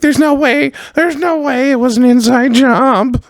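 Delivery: squawking falsetto, slowed and drawn out; shrieky Jim Norton impression. Mocking Norton's denial on the radio show, squawking falsetto. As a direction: shriek it like a little penguin. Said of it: "And Jim Norton was like".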